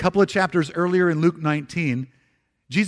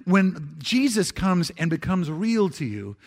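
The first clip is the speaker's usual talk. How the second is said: normal talking voice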